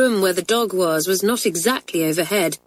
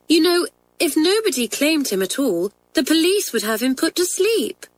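put-on high, earnest girlish pleading; anxious pleading; 6 semitones higher than usual. A narrator's reading. Girl fearing for the stray dog's life, anxious. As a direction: plead for him, pitch climbing with worry. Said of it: "asked Kathleen anxiously".

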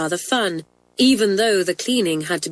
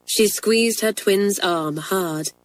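ordinary delivery

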